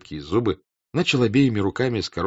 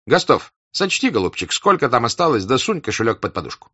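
burred hussar voice; 5 semitones higher, 5 dB louder than usual. A narrator's reading. Denisov instructs Rostov.

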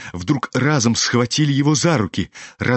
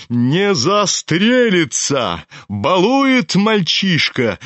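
high, slow pompous exclamation; drawn-out, pompous indignation; indignant, with aplomb; 6 semitones higher, much slower than usual. A narrator's reading. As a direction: proclaim it with outraged self-assurance. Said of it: "с негодованием и с опломбом неожиданно прокричал генерал".

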